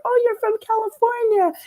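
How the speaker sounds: very high-pitched mock delight; suddenly changed voice. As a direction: imitate him gushing in a put-on high voice. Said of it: "And his voice changed".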